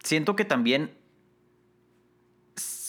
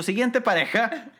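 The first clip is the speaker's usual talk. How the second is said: announcer voice; 11 semitones higher than usual. Acted. Host-like announcing.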